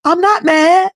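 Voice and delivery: mock devil shrugging it off; devil's voice. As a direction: play the devil, unbothered. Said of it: "the devil is saying".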